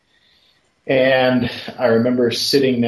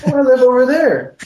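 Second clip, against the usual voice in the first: put-on polite little boy; 14 semitones higher, 6 dB louder, faster than usual. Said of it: "He said".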